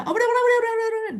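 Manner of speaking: excited agreement. Real, not acted. Eager agreement with student.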